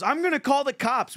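high-pitched imitation of an upset waiter; mock threatening waiter. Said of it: "He goes".

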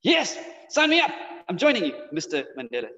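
eager acceptance. Acted. Honored recruit saying yes to Mister Mandela, eager. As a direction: jump at the offer, voice leaping up.

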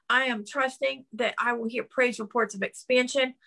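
normal talking voice

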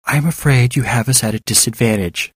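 ordinary delivery

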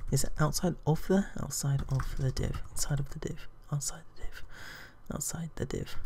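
hushed self-talk while working out the wording; muttering to self. Real, not acted. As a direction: trail off into a near-whisper. Thinking aloud while typing the sentence.

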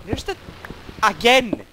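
loud, high burst of annoyance; exasperated shout. Real, not acted. Gamer hears the noise again, exasperated shout. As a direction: yell it out, fed up.

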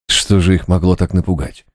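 ordinary delivery, nothing marked

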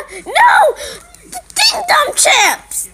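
mock dramatic shout; dramatic cry. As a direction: wail it like a tragedy. Dramatic cry over chips.

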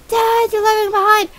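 put-on high, loud kid wail; left-behind son. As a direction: call after Dad in a child's voice.

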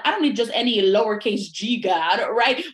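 usual speaking voice

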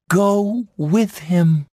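high, loud, slow plea; urging fawn Feline. A narrator's reading. Feline sending wounded Bambi after the prince, high urging. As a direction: urge it out high and drawn.

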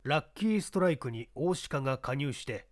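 usual speaking voice

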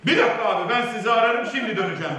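loud, high, hurried brush-off on the phone; a flustered, loud "I'll call you back"; frantic rush. Retold or imitated. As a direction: get them off the line fast, loud and high.

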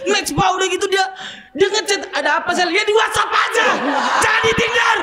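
excited high rant. Real, not acted. Excited rant about Tinder sister. (berteriak heboh dengan nada tinggi)

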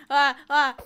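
two loud, high cries of fright; terrified screams. Real, not acted. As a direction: scream twice in panic. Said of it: "I'm terrified".

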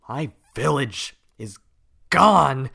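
loud, rising angry shout; angry outburst. Acted. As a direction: explode in grief and anger. Tahu raging over lost village.

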